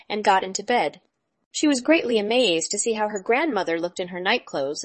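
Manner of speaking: usual speaking voice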